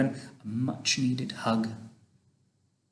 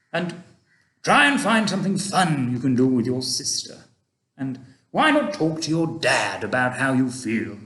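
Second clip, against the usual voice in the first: put-on a kindly old gentleman, louder and gently advising; Mister Stink; 4 dB louder than usual. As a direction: speak like an old tramp giving fatherly advice. Mister Stink voiced in story.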